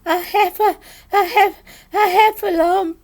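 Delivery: high, loud breathless stammer; panting, panicked little creature; Piglet out of breath. A narrator's reading. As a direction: gasp it out in broken bits. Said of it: "breathing so hard that he could barely speak".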